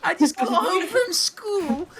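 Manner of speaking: put-on high, laughing kid voice begging mommy; whiny British kid. Mocking a British kid begging his mom for Twitch Prime, high and laughing. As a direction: play a little British kid pleading for the credit card through laughter. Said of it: "just like some British kid".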